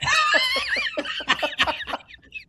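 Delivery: high, loud belly laugh; loud burst of laughter. Real, not acted. Golfer laughs hard after his Hobday punchline, loud and high. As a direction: crack up at your own story.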